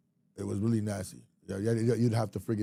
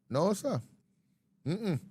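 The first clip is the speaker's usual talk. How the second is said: ordinary delivery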